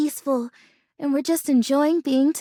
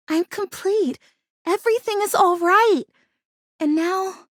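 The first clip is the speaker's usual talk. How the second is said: ordinary talk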